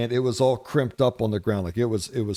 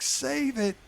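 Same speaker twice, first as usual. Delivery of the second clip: much higher imitated little kid crying out in panic; screaming kid; 12 semitones higher, much slower than usual. Little son begging daddy to save the wasp, high panicked child's voice. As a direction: play the small son pleading, pitched way up. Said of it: "He's like".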